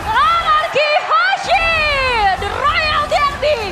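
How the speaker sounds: high, loud, slow victory shout; fighting game announcer. Real, not acted. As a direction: announce the champion at full roar. Winner announcement, victory call.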